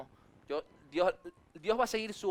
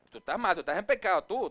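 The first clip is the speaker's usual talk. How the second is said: mock accusation; 5 dB louder than usual. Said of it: "restregarle a Cristo en la cara".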